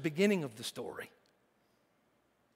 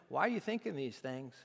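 softer, gentle probing voice; soft searching question; 6 semitones lower, 6 dB quieter than usual. Acted. Jesus to the teachers, quietly questioning. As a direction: ask them quietly what they think.

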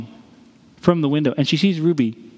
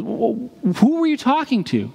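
questioning teacher; 8 semitones higher than usual. Retold or imitated.

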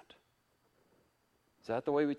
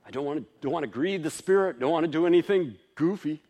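quick, higher, fretful self-talk; anxious inner voice; 5 semitones higher, 8 dB louder, faster than usual. Acted. Worried believer afraid to grieve the Spirit, quick and fretful. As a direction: rush it like a nervous believer.

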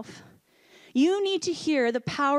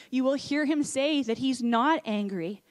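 normal talking voice

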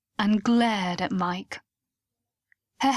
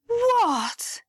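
shocked; 12 semitones higher than usual. Acted. Mike hears Lucy loves him.

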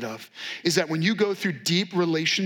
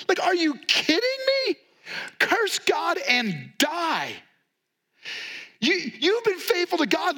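high, pleading wife's voice, much higher throughout; wife's exasperated plea; 8 semitones higher than usual. Retold or imitated. Wife urging Job to curse God, high exasperated plea. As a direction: throw the wife's despair at him, pitched way up. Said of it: "his wife said to him".

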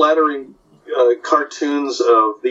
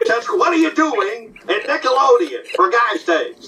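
Jack Benny voice; 8 semitones higher than usual. Retold or imitated. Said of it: "doing Benny and Rochester".

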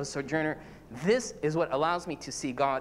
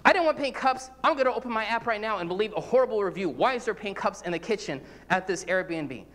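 mock complaint; 3 semitones higher than usual. Guest fussing over pink cups in rental, mock annoyed rant. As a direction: play up being fed up over pink cups. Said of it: "And I say".

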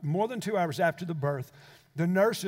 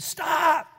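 sharp cry of stop; 9 semitones higher, 6 dB louder than usual. Retold or imitated. Faith cries out in crowded room.

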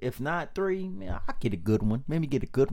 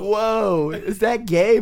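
lightly voiced little kid, higher and louder; 9 semitones higher, 10 dB louder, slower than usual. Grown man as his younger self reacting to grandpa, a hint of little kid. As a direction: blurt it out loud like a startled kid. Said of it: "as a little kid, I'm like".

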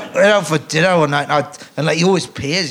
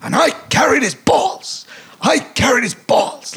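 high, precise comic line reading; Mel's line reading; 10 semitones higher than usual. Retold or imitated. Director demonstrating the line, high precise reading. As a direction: demonstrate the exact rhythm. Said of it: "He go".

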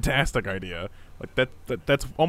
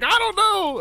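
much higher, louder mock girl voice; girlfriend voice; 5 dB louder than usual. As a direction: squeak it out like a ditzy girlfriend. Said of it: "And then she's like".

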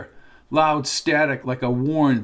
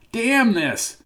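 frustrated; 8 semitones higher than usual. Acted. Carpin caught by the cords.

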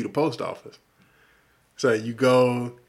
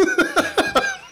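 sudden squealing laugh up high; delighted laughing burst; high burst of laughter; 3 dB louder than usual. Real, not acted. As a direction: laugh out loud, high and bright. Laugh after excited yes.

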